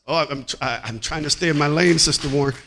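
ordinary talk

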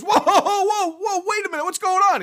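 high, loud, panicked whoa with a laugh; shocked whoa. Acted. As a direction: yelp it out, stunned at the flames. Man shocked at real hell.

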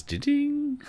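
high-pitched electronic ding; imitated chime. Controller turning on beside the wife, high imitated ding. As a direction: sing out a little startup chime.

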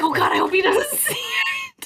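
high, laughing mock dread; high laughing worry; laughing panic. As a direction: squeal it through a laugh. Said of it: "I was like".

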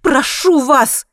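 high, loud, drawn-out retort; indignant. A narrator's reading. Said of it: "с негодованием ответила женщина".

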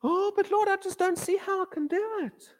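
mock whiny believer making excuses; doubting believer. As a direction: plead weakly, full of doubt. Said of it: "Now you're choking right there".

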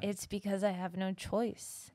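put-on light French accent, slow; a lightly French artiste, unhurried. Quoting a French climber, in a light French accent. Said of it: "he has a really thick French accent".

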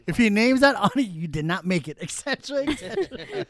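loud, high pompous gatekeeper; mock official. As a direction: announce it like a snooty bouncer.

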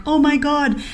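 high-pitched, overcome reaction; retold starstruck gush. Host retells reacting to a fan, high starstruck gush. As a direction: act touched and amazed, pitched well up.

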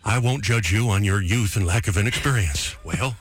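measured mock-presidential delivery; Reagan. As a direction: imitate the president, slowly and deliberately. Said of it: "I'm doing my impression".

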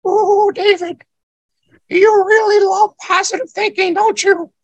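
very high, squeaky Indian guru impression; Indian accent. Telling how his tiny guru teased him, high Indian voice. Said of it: "he talked in that Indian voice".

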